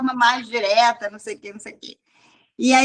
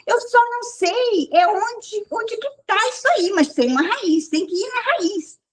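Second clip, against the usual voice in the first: high, put-on voice of a motherly woman, much higher; imitating the motherly hairdresser; 8 semitones higher than usual. Mimicking the salon lady advising the girl, in a high voice. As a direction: play the earnest salon lady, pitched well up. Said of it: "mas ela dizia assim".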